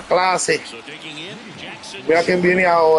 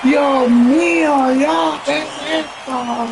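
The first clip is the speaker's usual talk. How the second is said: high, slow, amazed cries; awed exclaiming; 7 semitones higher, slower than usual. Real, not acted. Fan marvels at the game, high and slow. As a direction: marvel aloud, drawn out.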